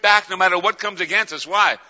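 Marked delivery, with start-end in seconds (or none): none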